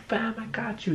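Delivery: whispered aside; breathy secret whisper. Acted.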